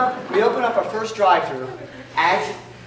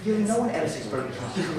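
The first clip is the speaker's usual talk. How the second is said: ordinary delivery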